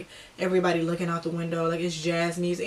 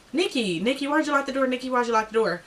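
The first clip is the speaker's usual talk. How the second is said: higher-pitched imitation of a worried friend; calling through door; 5 semitones higher than usual. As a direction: pester her through the locked door, pitched up.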